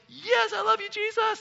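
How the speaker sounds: high, squealing love you Jesus; fangirl worship. Retold or imitated. Preacher imagining worshippers squealing to Jesus like teen girls. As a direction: gush like a screaming teen fan. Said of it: "like those junior high girls".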